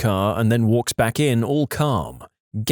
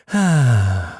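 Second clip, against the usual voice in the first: loud, falling sigh; big audible sigh; heavy sigh; 4 dB louder than usual. Acted. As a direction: let out a long, heavy breath. Car has zero problems, then sighs.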